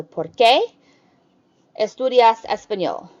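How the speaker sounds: "qué" punched loud and rising; stress on qué. Shown as a demonstration. Teacher showing where the why-question stress falls. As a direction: hit "qué" hard. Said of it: "the emphasis changes to the E".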